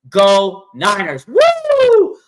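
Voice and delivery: slow, loud, high cheer; team cheer. Real, not acted.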